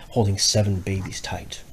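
plain reading voice

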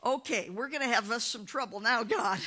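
high sheepish reply; a touch of mock panic; lightly voiced caught-out preacher. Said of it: "And he said".

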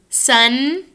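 "sun." with a front N. Shown as a demonstration. Teacher drilling sun against sung, front N. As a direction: end "sun." on the tongue tip. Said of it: "let's say sun".

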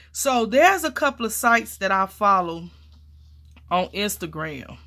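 ordinary talk, nothing marked

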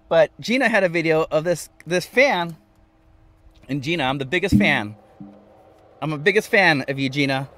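normal talking voice

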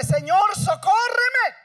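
loud, high, slow cry for help; desperate cry. Acted. Woman on her knees begging Jesus, loud drawn-out cry. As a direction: wail it out, stretching socórreme. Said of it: "diciéndole".

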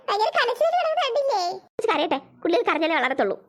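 ordinary talk

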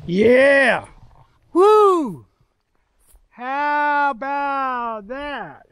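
high whoop, then a long drawn-out marvel; elated cheering. Real, not acted. Hiker showing viewers the downhill valley, elated cheering. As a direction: let out a joyful high holler, stretching the last words.

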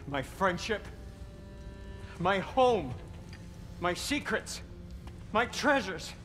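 from hurt to furious shouting; building fury. Acted. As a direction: pile it up until he explodes. Betrayed friend building to fury.

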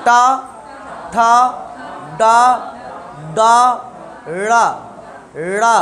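टा, ठा, डा struck apart with tongue curled back; each syllable distinct, retroflex टा to ड़ा; clear retroflex demonstration. Shown as a demonstration. Teacher repeating the drill, clear retroflex टा, ठा, डा, ड़ा. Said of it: "आवाज़ स्पष्ट होनी चाहिए".

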